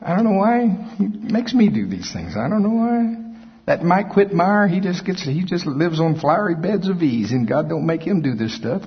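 a mock self-pitying moan; whiny complainer. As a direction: pout and whine like a put-upon saint. Mimics whiny complaining believer.

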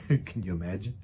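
usual speaking voice

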